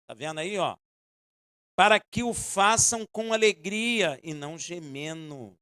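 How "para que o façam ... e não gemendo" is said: loud, slow reading of the verse; preaching the verse with force; loud scripture reading. Acted. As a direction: proclaim the verse to the church. Reads Bible verse aloud.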